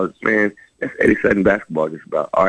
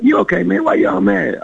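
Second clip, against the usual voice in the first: much higher-pitched, carefree questioning; imitated happy roommate; 12 semitones higher than usual. Happy roommate walking in on a pissed teammate, imitated cheer. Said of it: "laughing, like, kinda all happy".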